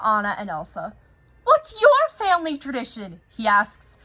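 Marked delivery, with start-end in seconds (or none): 1.4-3.2 eager question. Acted. Olaf asks eagerly.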